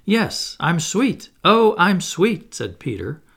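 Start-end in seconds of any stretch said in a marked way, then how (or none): 0.0-1.3 pleased with himself. A narrator's reading. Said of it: "forgetting his manners again". 1.4-2.5 "Oh, I'm sweet" drawn out slow and louder, higher; smug. A narrator's reading. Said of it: "forgetting his manners again".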